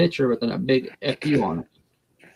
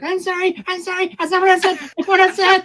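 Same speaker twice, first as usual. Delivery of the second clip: high, squeaky pleading; hammed-up panicky apology, pitched way up; frantic mock apology; 4 dB louder than usual. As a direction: plead in a much higher put-on voice. Acting out frantic apology at the door.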